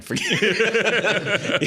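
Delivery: high laughing giveup; squeaky laughing dismissal. Real, not acted. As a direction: laugh the words out high and slow. Laughing off a bad moment.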